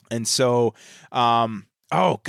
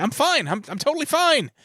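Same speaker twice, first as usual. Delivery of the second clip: much higher, strained "I'm fine"; mock manic Clark. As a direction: sell that you're fine while cracking. Clark insisting he's fine.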